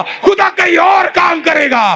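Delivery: high, loud, slow preaching cry; shouted refrain. Acted. Pastor crying out the refrain to the congregation, loud. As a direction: belt the line to the back row.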